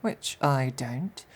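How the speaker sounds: ordinary talk, nothing marked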